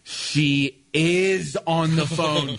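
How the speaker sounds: drawn-out, dazed insistence; slow insisting. Retold or imitated. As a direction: spell it out slowly.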